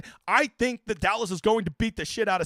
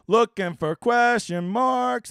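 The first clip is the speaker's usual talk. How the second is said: slow mumble; 4 semitones higher, 4 dB louder, much slower than usual. Real, not acted. Reading chat questions aloud.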